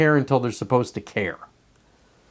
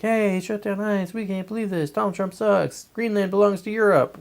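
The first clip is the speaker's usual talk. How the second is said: higher, soft sing-song protester voice; mock friendly protester; 7 semitones higher, 4 dB quieter than usual. Imitating peaceful protesters with signs, higher and gentle. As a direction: chirp it like a nice sign-holder. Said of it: "and like".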